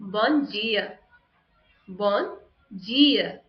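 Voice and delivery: "bom dia" with dia as "jee-a"; Brazilian accent. Shown as a demonstration. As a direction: Brazilian Portuguese, j sound opening dia. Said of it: "In Brazilian accent".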